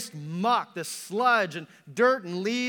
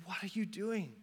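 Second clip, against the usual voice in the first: soft question to a wayward people; hushed appeal; 10 dB quieter than usual. Acted. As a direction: ask it softly, in one run. God pleads with his people.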